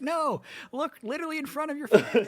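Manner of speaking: high, laughing mock exasperation; exasperated crowd. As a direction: play the frustrated fan pointing. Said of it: "everyone else in the stands is like".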